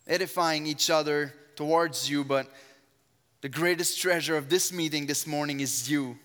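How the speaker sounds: usual speaking voice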